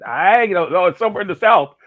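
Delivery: loud, high, helpless blurt; exasperated comic reply. Retold or imitated. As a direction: throw up a clueless answer for laughs. Retold clueless answer to his wife.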